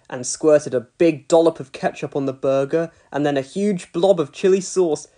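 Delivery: ordinary delivery, nothing marked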